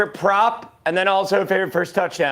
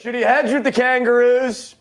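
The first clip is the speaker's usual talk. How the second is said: put-on Australian accent, higher-pitched; 5 semitones higher than usual. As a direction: read it out like a drawling Aussie caller. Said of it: "answer in the Australian accent".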